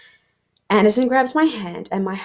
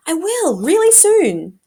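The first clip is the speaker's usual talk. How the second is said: high, louder defensive promise; defensive insisting; 9 semitones higher, 4 dB louder, slower than usual. Acted. Kate cornered about driving, high defensive insisting. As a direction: squeak it out like you're caught.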